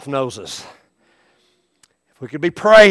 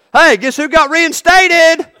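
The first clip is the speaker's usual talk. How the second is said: high, loud, slow jolly greeting; cheery careless doctor; 12 semitones higher, 8 dB louder, slower than usual. Acted. Doctor bebopping down the hall, loud jolly boast. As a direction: breeze in far too cheerful.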